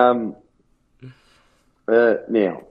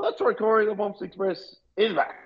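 hyped up; 11 semitones higher, 4 dB quieter than usual. Acted. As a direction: announce yourself with big energy. Hyped self-introduction.

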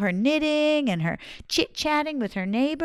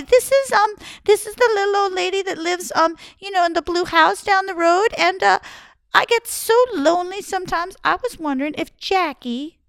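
put-on high, falsely sweet old lady voice; 8 semitones higher, 5 dB louder than usual. Old woman lures Jackie through his mother, high sweet old lady. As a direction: act innocent and lonely.

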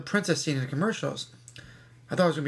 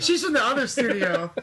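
laughing through the quoted line, higher; 8 semitones higher than usual. Retold or imitated. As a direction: repeat the line while cracking up. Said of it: "What'd she say?".

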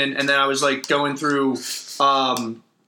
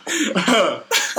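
laughing; 7 semitones higher than usual. Real, not acted. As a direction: laugh while you tell it. Laughing at his own joke.

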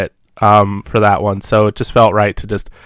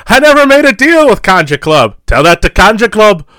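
high, louder menacing gangster line; movie gangster quote; 8 semitones higher, 7 dB louder than usual. Retold or imitated. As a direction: bark it like a movie thug. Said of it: "when they come in, like".

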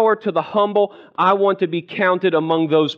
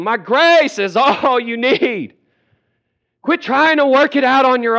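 hollering; 7 semitones higher, 4 dB louder than usual. Acted. Jesus hollering at a stubborn believer, high holler. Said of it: "Jesus has been hollering at you".

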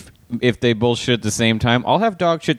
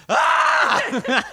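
high-pitched yell into the toilet; mock scream. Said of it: "there's a part of you that's screaming".

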